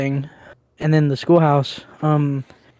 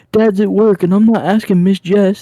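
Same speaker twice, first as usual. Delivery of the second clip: put-on a higher, louder kid's whine; imitating little brother; 5 semitones higher, 4 dB louder than usual. Quoting his brother refusing to ask the stepmom, higher whine. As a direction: refuse like a stubborn kid. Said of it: "He's like".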